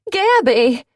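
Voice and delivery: loud high call of her name; cried out. A narrator's reading. Cleo calling to Gabby on the ground, loud cry. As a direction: call out to a friend in trouble. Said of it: "Cleo cried".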